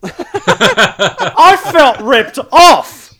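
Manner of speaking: loud high laughing outrage; outraged. Real, not acted. Telling mates he was ripped off, loud laughing outrage. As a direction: bellow the rip-off, laughing.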